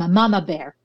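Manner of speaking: normal talking voice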